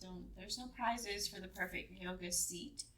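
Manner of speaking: usual speaking voice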